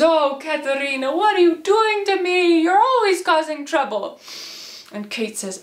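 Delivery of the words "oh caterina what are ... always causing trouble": high, loud wailing reproach; exasperated father. Acted. Papa scolding Kate after the fight, exasperated wail. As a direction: scold in hammed-up despair.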